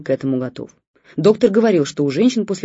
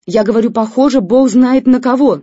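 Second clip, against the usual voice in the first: loud, high angry yell; shouting; 7 semitones higher, 12 dB louder than usual. A narrator's reading. Wife yells at husband about her looks. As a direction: shout it out in frustration. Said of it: "закричала жена".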